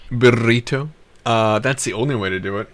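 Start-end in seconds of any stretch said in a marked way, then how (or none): 0.1-0.9 Burrito with a rolled trilled r. Shown as a demonstration. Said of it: "why are you rolling your R like that".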